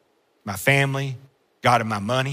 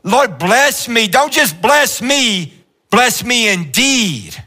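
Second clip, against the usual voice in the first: high, slow, fervent preacher cry; full-force closing appeal; preaching climax; 8 semitones higher, 4 dB louder than usual. Acted. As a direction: drive it home to the room.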